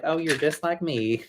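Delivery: mock Southern accent for a cartoon dinosaur; fake Southern accent. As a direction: say it like a Texas gal, half joking. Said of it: "that fake Southern accent".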